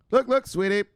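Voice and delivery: loud, high call to a sweetheart; brash come-on. Acted. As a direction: grab her attention, cocky. Acts out calling to woman.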